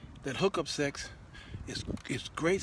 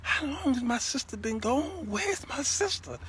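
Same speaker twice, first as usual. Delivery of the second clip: panicked thinking; 11 semitones higher than usual. Retold or imitated. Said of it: "I'm like".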